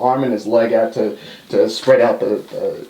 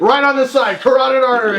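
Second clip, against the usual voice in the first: higher, lightly hammed; mock Bret; 10 semitones higher, faster than usual. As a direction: play it dumb and eager. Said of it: "He's like".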